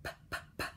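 voiceless puffs "P, p, p"; quiet p sound. Shown as a demonstration. Teacher models the quiet p for kids. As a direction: pop it softly. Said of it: "Quiet tongue dancing".

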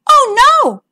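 high, bright-voiced cry of dismay. A narrator's reading. Princess cries out as her ball lands in the pond, high dismay. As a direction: gasp it out as the ball sinks. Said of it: "cried the princess".

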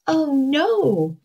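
high little goat's cry of alarm; high-pitched, narrated alarm. A narrator's reading. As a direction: cry out high as a small storybook goat. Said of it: "cried the billy goat".

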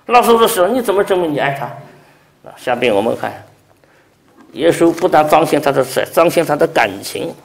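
loud, high impassioned preaching. Acted. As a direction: rouse the congregation. Preaching at full force.

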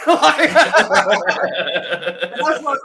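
ordinary talk, nothing marked

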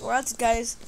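usual speaking voice

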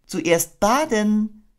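bright, slow, chirpy motherly voice; cheerful Kanga. Acted. Kanga telling her baby it's bath time, cheerfully. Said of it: "mit munterer Stimme".

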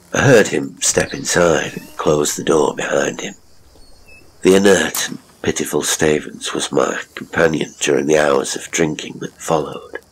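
ordinary delivery

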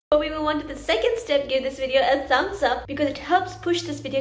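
quick, bright ask for a thumbs up; upbeat call to action. Acted.

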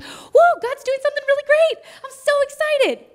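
high giddy whoa; excited. Retold or imitated. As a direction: bubble over with retold excitement. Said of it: "went home so excited".

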